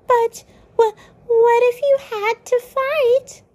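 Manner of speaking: high alarmed Lancer voice; shocked Lancer. Acted. Lancer horrified at Susie's talk of fighting, high. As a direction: squeak it out in alarm. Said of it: "Lancer's face is shocked".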